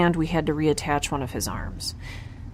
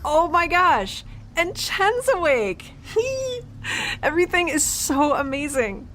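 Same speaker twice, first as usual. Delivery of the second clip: thrilled; 11 semitones higher than usual. Acted. She sees her injured friend awake and gushes, high and delighted. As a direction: gush with joy and laughter.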